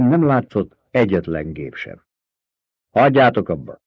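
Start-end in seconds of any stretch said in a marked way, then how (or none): 2.9-3.8 mild read shout; understated shout. A narrator's reading. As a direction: call out to stop the fighting. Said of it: "kiáltott el magát Görni".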